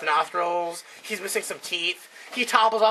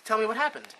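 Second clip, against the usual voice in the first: higher, fussing mom voice; worried mother; 6 semitones higher than usual. Acted. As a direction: play the anxious doting mother. Mother voiced in a joke story.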